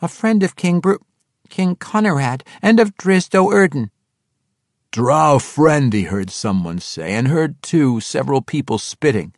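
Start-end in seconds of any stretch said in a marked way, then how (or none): 0.0-3.9 high, insistent halfling voice; pleading halfling. A narrator's reading. Halfling names his friends to his captors, high and pleading. 4.9-5.9 loud, scornful outburst; hostile accusation. A narrator's reading. Someone in the crowd accuses the halfling, hostile. Hostile bystander reacts, others spit.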